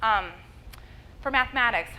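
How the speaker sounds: normal talking voice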